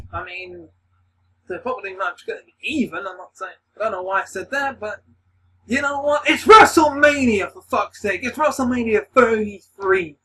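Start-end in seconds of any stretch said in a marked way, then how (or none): 5.6-10.2 higher and louder, yelling it out; excited shout. Real, not acted. Fan hyping WrestleMania to his viewers, excited shout. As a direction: shout WrestleMania like a fan who can't contain it.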